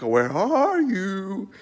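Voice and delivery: very slow, drawn-out calling voice for God. Retold or imitated. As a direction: stretch it out like a booming call through the garden. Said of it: "God's like".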